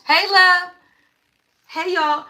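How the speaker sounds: light up greeting a viewer; excited greeting. Real, not acted.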